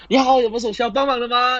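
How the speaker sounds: high, fast, eager shop clerk voice; pushy salesclerk. Acted. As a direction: chirp it like an overeager clerk. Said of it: "说：".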